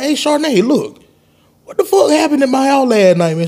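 confused question. Retold or imitated. Confused host asks Charnay about last night, high and baffled. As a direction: ask it high and bewildered. Asking friend about the party.

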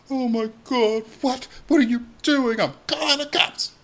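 high, alarmed mock outrage; panicked Ken. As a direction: imitate him freaking out. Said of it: "And he's like".

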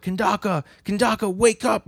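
high-pitched imitation of calling someone to wake; mock urgent cry. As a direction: act out trying to rouse a fallen friend, pitch way up. Acting out character rousing fallen friend.

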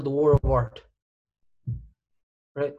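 ordinary talk, nothing marked

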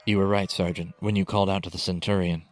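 ordinary delivery

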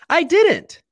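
I didn't, cracking high and loud; emphatic denial. Real, not acted. To listeners, insisting he wished no harm, high and loud. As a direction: insist hard, voice jumping up.

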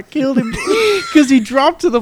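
loud, high-pitched, breaking up with laughter; laughing while telling. Real, not acted. Golfer quoting his alarmed friend, cracking up. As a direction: push the words out through real laughter. Laughing through the retold panic.

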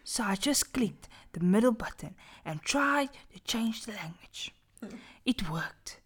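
hushed spooky storytelling; dramatic whisper. Acted. Reading a horror story aloud to friends, whispered. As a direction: whisper it to give chills.

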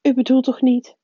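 the strict witch crying out in protest; cried out. A narrator's reading. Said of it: "riep professor Anderling".

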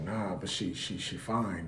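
dazed by her, voice dropped low and hushed; nervous student mumbling low about the girl; smitten young guy. Retold or imitated. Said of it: "He's like".

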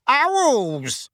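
high, loud bellow. Acted. Uncle bellowing at the owl, loud. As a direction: roar it out in fury. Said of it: "bellowed Uncle Vernon".

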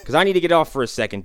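ordinary talk, nothing marked

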